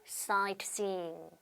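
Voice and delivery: "sightseeing" with a long "see", slow; long C sound. Shown as a demonstration. Teacher stressing the long vowel in "sightseeing". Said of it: "C 장음으로".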